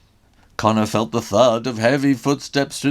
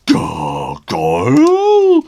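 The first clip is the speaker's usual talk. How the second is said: loud, slow, drawn-out yelp; alarmed yelp; 8 semitones lower, 11 dB louder, much slower than usual. Acted. Ogre shocked that Connor's mum is a gargoyle, yelping. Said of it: "the ogre yelped".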